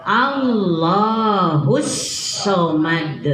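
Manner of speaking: very slow "Allahus" with doubled l; pressed lam. Shown as a demonstration. Teacher modelling the pressed lam, slow. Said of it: "Bacanya ditekan ya".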